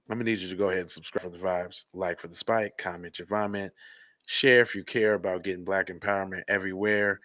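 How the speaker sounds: fast, low, soft sign-off patter; soft, quick channel outro patter. Acted. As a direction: rattle off the closing call to action.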